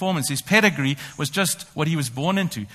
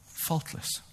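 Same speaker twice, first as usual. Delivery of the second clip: hushed whisper; 11 dB quieter than usual. Acted. Echoing Paul's boast, hushed.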